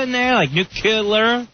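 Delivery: nuclear said with an extra k sound, nu-kih-lear, slow; wrong nuclear. Shown as a demonstration. Mocking the host's way of saying it, nuclear with an added k. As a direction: squeeze a k into nuclear. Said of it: "putting that extra "k".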